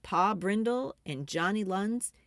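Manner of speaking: usual speaking voice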